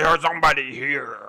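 loud gruff ogre cry. Acted. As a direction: bellow it like a startled ogre. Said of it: "That was my ogre voice".

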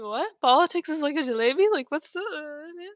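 loud, high incredulous question; shocked disbelief. Retold or imitated. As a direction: react like you can't believe it. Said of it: "the way my sister's head snapped".

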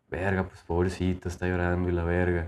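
poor thing, said under the breath; a soft sympathetic aside to himself; pitying aside. Retold or imitated. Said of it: "y digo".

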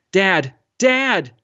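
high-pitched call for a father, rising on the second; desperate cry. A narrator's reading. Boy in the sea to his vanishing father, desperate cry. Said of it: "Mahmoud cried".

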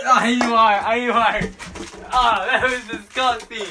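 loud, high cry of disgust. Real, not acted. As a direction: recoil at the awful taste, loudly. Tasting a gross jellybean.

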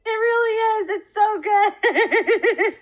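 high, drawn-out gushing with a laugh; delighted gush. Real, not acted. Gushing over the clip.

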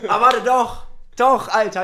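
excited. Real, not acted. Suddenly remembers a story.

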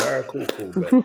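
dismissive. Retold or imitated. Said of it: "I said".

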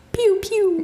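high little pew-pew shots; toy-like mimicked rifle fire; imitated gunfire. Telling how scouts picked soldiers off, high mock gunshots.

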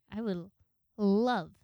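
a burst on love; sudden loud emphasis. Retold or imitated. Thinking to herself, a sudden loud love. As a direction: punch out love.

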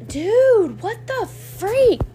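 high, loud, drawn-out "what the freak"; shocked outburst. Real, not acted. Vlogger yelling at the noise outside, loud and shocked. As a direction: blurt it out at a racket outside.

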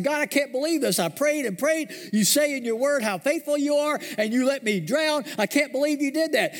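high-pitched indignant complaint; mad at God. Acted. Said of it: "he was so mad at God".

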